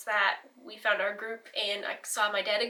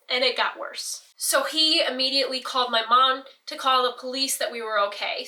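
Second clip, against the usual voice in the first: ordinary delivery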